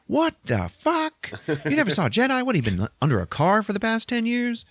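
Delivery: very high, squealing disbelief; incredulous outburst. Acted. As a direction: stretch the opening, appalled schoolgirl voice. Girl incredulous he never saw Jedi.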